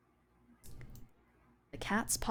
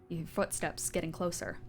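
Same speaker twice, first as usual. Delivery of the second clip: ordinary talk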